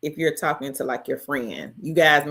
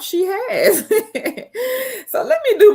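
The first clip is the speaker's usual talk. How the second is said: laughing; 6 dB louder than usual. Real, not acted. Laughing at a chat comment.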